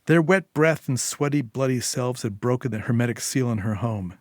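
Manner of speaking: usual speaking voice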